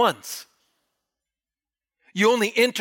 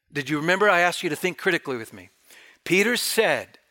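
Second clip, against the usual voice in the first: ordinary talk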